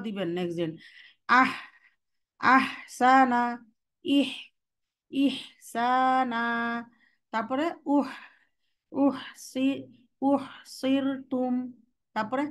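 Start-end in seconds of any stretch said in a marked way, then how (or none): 1.4-3.4 slow drawn-out recitation of আহসানা; Quranic letter drill. Shown as a demonstration. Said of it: "এখানে বলছে কি দেখেন সুকুনটা". 4.0-6.4 slow drawn-out recitation of আহসানা; Quranic letter drill. Shown as a demonstration. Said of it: "দেখেন সুকুনটা". 8.0-11.5 slow drawn-out recitation of আহসানা; Quranic letter drill. Shown as a demonstration. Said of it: "দেখেন সুকুনটা".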